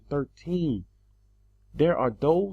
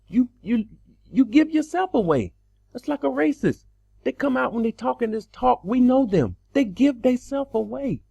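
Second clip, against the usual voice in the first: higher, louder preaching call-out; preacher calling out critics, impassioned; 7 semitones higher, 4 dB louder than usual. Real, not acted. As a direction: raise the voice to call them out.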